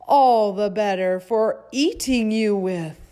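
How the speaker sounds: loud, gloating wolf voice; wolf booms out his threat; big bad wolf. A narrator's reading. Wolf's final menacing line.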